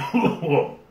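choking cough; sudden cough from pepper heat; sharp cough. Real, not acted. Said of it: "Choking on my, uh, saliva".

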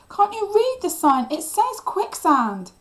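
loud, high exasperated shout; furious cry. A narrator's reading. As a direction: scold at the top of your voice. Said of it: "I cried".

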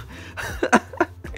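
breathy, voiceless burst of laughter; stressed-out giggle; helpless laugh. Real, not acted. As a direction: laugh at your own predicament. Laughs at own helpless plea.

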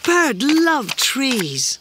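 high, drawn-out little creature voice; excited calling out. A narrator's reading. Small Creature remembers what Bird loved, high slow excited call. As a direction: stretch each word, high and eager. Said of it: "shouted Small Creature".

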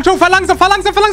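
high, urgent pleading; panicked passenger. Acted. Passenger to boy driving too fast, high panicked plea. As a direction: beg the driver in rising alarm. Frightened passenger in speeding Bugatti.

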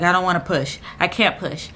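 usual speaking voice

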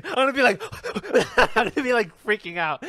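laughing. Real, not acted. Laughing about imagined panic.

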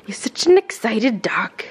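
ordinary delivery

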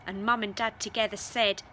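plain reading voice

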